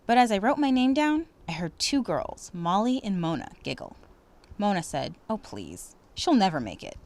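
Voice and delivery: expressive read-aloud storytelling; story narration. Acted. Teacher reading a story to students, expressive narration. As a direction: read it warmly to the class.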